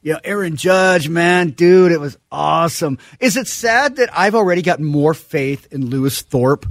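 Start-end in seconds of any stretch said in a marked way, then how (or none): 0.0-3.0 surfer dude. Acted. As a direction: stretch the words like a laid-back surfer. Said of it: "He sounds like Surfer Guy".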